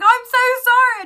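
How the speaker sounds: high, loud squeal of an apology; shrill-high mock wail of guilt; squealed sorry. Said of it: "And I was like".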